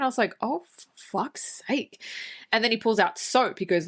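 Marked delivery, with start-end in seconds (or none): 0.4-2.0 slow, softer, higher groan of disbelief; retold weary exasperation; mock exasperation. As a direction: drag it out as if despairing.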